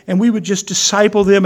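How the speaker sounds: normal talking voice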